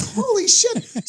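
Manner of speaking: high, very slow disbelief; stunned. Retold or imitated. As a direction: drag it out in shock. McMahon reacts in disbelief.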